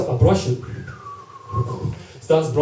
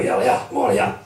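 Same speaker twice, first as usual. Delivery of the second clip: much higher, airy mock call to the boy; voicing Big W calling over; 9 semitones higher than usual. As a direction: beckon the chain boy over in a put-on voice. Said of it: "he's like, to the chain boy".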